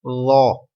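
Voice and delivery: زو with a thick, rounded th as in "this", no whistle; heavy unwhistled first sound in زو; soft emphatic letter. Shown as a demonstration. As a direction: say زو full-mouthed and soft, without a z hiss. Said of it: "کیا پڑھنا ہے؟".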